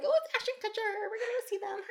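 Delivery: put-on high-pitched excited young fangirls; young girl fans. Young girls heading to see their movie idol, mock excited. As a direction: imitate young fans squealing softly, very high. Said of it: "younger girls in the theater".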